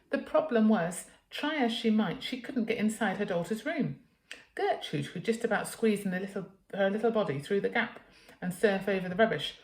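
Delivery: plain reading voice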